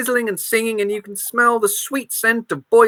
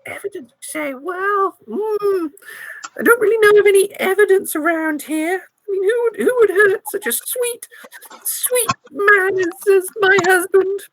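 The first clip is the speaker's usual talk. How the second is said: innocent granny mourning her husband; old granny voice; 10 semitones higher than usual. Acted. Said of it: "my old granny voice".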